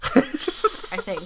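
whispered. Pretend. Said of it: "doubtful whispered echo".

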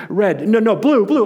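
imitating a rattled knight changing his answer; mock nervous. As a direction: blurt it in a pretend panic. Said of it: "he's so nervous, he says".

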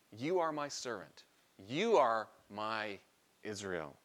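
"You are my servant. You are my Israel." slowly, weight on "You" each time; slow and emphatic, God speaking straight to the Messiah; emphatic address. Acted. God speaking emphatically to Jesus, slow stress on "You". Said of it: "God is being emphatic".

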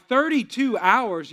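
retold stunned repeat, very slow; incredulous echo. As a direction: stretch it out in mock astonishment. Stunned reaction to length of labor.

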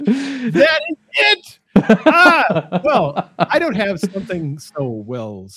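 high, slow laughing cheer; laughing delight. Real, not acted. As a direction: crack up with delight. Delighted by co-host's crow voice.

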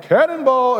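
high-pitched cannonball whoop; mock gleeful yell. Preacher imagines Peter hurling himself overboard, mock gleeful yell. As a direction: call it out like a playful jump into water.

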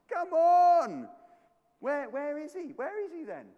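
high-pitched taunting call, loud at first; Elijah. Acted. Elijah asking where Baal has gone, high taunting. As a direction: jeer upward as if hunting for him. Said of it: "here is Elijah taunting him".

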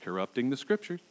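low, hushed mock muttering; imagined grumbling listener. Preacher imitating someone still sitting there in the sermon, hushed grumble. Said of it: "someone's still sitting there going".

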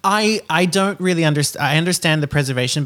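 usual speaking voice

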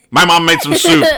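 hammed-up soup line, cracking up; laughing mock reply. Walking in the door, blurting laughingly. Acting the morning greeting reply.

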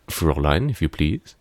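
put-on German accent. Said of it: "when you do the German accent so much".

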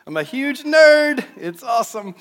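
high, loud and slow, gleefully nerdy; excited. Real, not acted. Preacher confessing his love of practical application, delighted. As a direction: relish admitting you love it. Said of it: "that's my favorite".

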